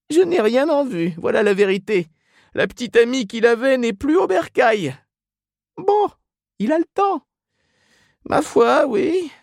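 very high put-on women's voices; gossiping women. A narrator's reading. Women discuss Ignatius's love life at counter, gossipy. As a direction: swap family news in a high falsetto.

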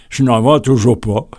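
puzzled shopkeeper, lightly voiced; mildly surprised vendor. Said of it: "m'a-t-il répondu, un peu étonné".

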